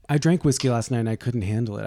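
ordinary delivery

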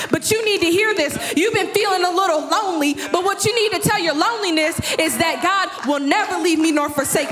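high, driving sermon delivery; impassioned preaching. Real, not acted. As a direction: build and press it home to the congregation. Preaches at rising full force.

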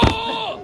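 high strangled cry with laughter; choked cry. Retold or imitated. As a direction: gasp it out, choking, half laughing. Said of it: "And I'm like".

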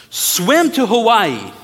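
loud, slow mock order to the crowd; called-out command. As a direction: bark it out slowly like an impossible order. Preacher gives absurd command.

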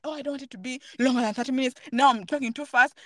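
quick, fretting inner monologue; rushed. Retold or imitated. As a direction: rattle it off like your own racing thoughts. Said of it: "I was even rushing videos".

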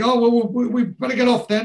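very high, loud, flustered imitation; dodgy excuse. Shifty lads making excuses to leave, imitated flustered voices. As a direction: act out shifty lads making their exit. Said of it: "Oz looks dodgy".

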